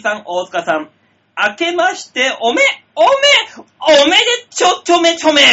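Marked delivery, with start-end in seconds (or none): 1.5-5.4 put-on higher and louder, slowed, childish lisp on ちょちょめちょめ; cutesy sing-song greeting. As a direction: coo the silly pun like a cutesy child. Reading cutesy pun greeting.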